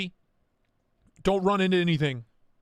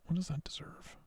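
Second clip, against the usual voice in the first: breathy whispered question; 12 dB quieter than usual. Shown as a demonstration. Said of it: "whispered repeat".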